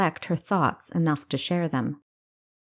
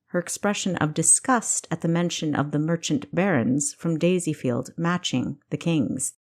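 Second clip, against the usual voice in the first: ordinary delivery, nothing marked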